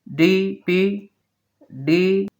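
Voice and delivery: ordinary talk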